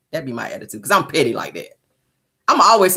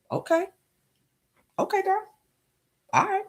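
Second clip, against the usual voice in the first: high, soft, wary agreement; high-pitched, cautious okay; humouring her; 13 semitones higher, 8 dB quieter than usual. Acted. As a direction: placate her in a small high voice. Humouring the crier.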